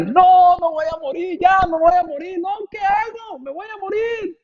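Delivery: high, whiny panic about dying; panicked patient. Retold or imitated. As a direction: pitch it up like a scared hypochondriac. Sick man panics over diagnosis. (paciente asustado, voz aguda y quejumbrosa)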